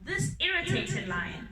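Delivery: ordinary delivery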